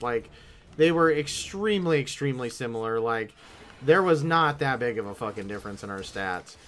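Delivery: ordinary talk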